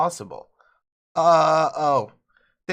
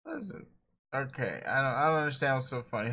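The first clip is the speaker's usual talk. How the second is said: ordinary delivery, nothing marked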